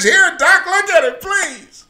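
high, loud begging; mock pleading. Patient begging the doctor, high and loud. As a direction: beg the doc, voice up.

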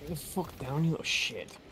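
swearing under the breath; muttered cursing. Real, not acted. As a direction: curse quietly at the screen.

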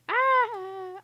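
high, loud drawn-out "ah" scream; sudden silly scream. Pretend. Gamer losing track of beluga babble, sudden high scream. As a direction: burst into a loud, high yell.

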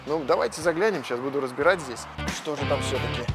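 usual speaking voice

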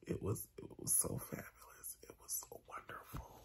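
breathy whispered gush, barely audible. Real, not acted. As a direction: whisper it, overcome with joy. Whispered gushing about the parade.

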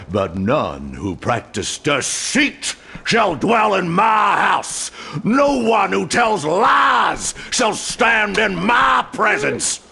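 a pastor roaring judgment at a traitor, slow and furious; wrathful sermon shout. Acted. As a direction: thunder the scripture down on the liar. Pastor turns on the deceiver.

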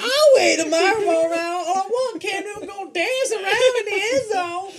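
high, laughing mock announcer; mock radio host. Gamer mocking a radio host, high and laughing. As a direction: ham up a giddy radio voice.

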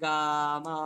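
গা and মা held long; lengthened গা মা, lingering on each; drawn-out syllables. Shown as a demonstration. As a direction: stretch the vowel of each, গা... মা. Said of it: "দীর্ঘ সময় নিয়ে বলছে".

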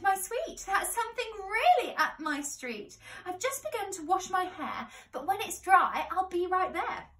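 put-on obliging young lady on the phone.

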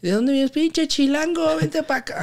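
mock yelling teammate. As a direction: imitate the bully calling across the house. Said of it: "Así, güey, me gritaba". (imitando un grito burlón, mucho más agudo)

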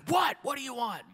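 high, loud irritated what; annoyed snap. Retold or imitated. Stranger annoyed at Tom, loud high retort. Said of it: "The guy's like".

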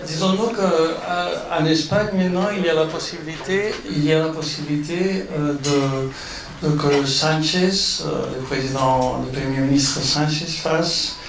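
Spanish-accented French; foreign accent in french. Real, not acted. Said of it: "mon français c'est toujours bon".

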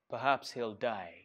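ordinary delivery

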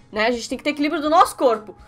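ordinary talk, nothing marked

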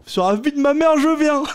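high, loud excited vow; eager promise. Retold or imitated. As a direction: swear it with bursting enthusiasm. Mamadou voiced, eager promise. (promesse enthousiaste, voix haute et forte)

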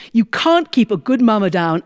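usual speaking voice

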